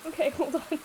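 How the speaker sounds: ordinary delivery, nothing marked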